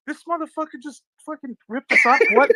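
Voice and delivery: high-pitched, incredulous mock outrage; worked-up disbelief, pitched well up; retold indignation. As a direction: act out being outraged at a rip-off. Retold outrage at being copied.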